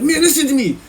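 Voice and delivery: high-pitched mock anger; mad cellmate. Older cellmate getting mad, high mock anger. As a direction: demand attention in a high angry imitation. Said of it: "he's get all mad".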